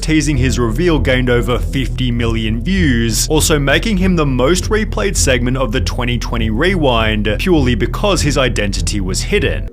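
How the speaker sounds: loud, slow, hyped documentary voice; dramatic narration. Acted. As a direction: narrate it like a doom-laden exposé. Dramatic commentary narration parody.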